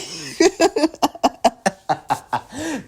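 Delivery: long, high, loud cackle; real belly laugh. Real, not acted. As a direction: crack up helplessly. Cracking up at Shrek taunt.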